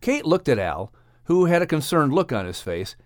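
usual speaking voice

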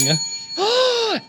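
high, drawn-out gasp; theatrical gasp. Acted. Improviser reacting in the scene, theatrical gasp. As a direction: gasp sharply for effect.

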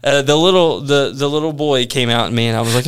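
usual speaking voice

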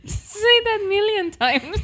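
words squeezed out through laughter; laughing. Real, not acted. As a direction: laugh while saying it.

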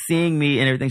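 usual speaking voice

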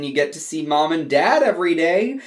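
usual speaking voice

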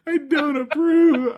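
giggly high echo; laughing. Real, not acted. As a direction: repeat it through laughter. Mimics partner while laughing.

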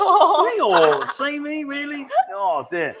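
very high, squealed mock astonishment; laughing disbelief. Host reacting to her answer about age, high mock disbelief. Feigned shock, laughing along.

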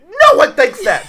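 high, loud, drawn-out mock yell; mimicked yell. Accusing a friend of yelling it, mimicked yell. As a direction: throw the yell back, exaggerated and stretched out. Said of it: "You yelled it. You said".

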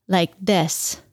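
talking with a pencil between the teeth. Shown as a demonstration. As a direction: speak with a pencil held in the teeth. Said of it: "hold a pencil in their teeth".

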